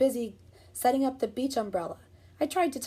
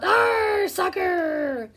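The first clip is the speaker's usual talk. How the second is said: very high loud pirate shout; captain kicking the ball; pirate yell; 8 semitones higher, 7 dB louder, much slower than usual. Acted. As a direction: roar it like a pirate. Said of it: "kicked the ball and yelled".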